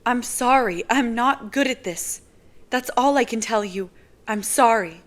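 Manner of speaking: put-on embarrassed girl apologizing; apologetic young woman. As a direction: sound sorry and out of your depth. [name]'s higher voice.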